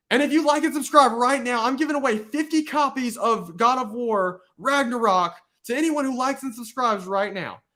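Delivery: high-pitched hyped giveaway promo; YouTuber giveaway pitch. Acted. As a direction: pitch it like a YouTuber pushing likes and subscribes. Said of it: "he could be like".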